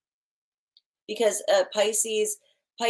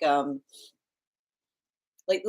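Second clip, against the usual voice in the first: ordinary talk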